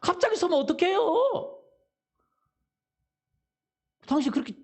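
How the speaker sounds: irritated shout, slowing on the complaint; a hammed-up scolding from the driver behind; a touch of road rage, acted for the congregation; mock angry driver. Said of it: "막 큰 소리 치네요".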